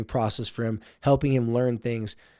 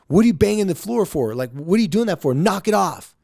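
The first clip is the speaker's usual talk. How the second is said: louder, higher scolding yell; mock angry dad; 7 semitones higher, 6 dB louder than usual. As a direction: snap at the kid like a mad dad would. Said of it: "when I get mad".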